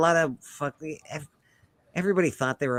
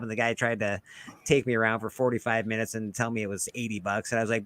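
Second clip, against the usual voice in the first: ordinary delivery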